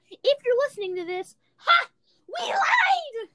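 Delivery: high, shrieking gloat; yelling to Maya that they lied, shrieking with glee; gloating taunt. Real, not acted. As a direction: crow it in her face, laughing.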